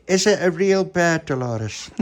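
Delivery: high, loud, slow question; high child's voice, drawn out; wondering child. Acted. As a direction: ask slowly and high in wonder. Pauline asks in wonder.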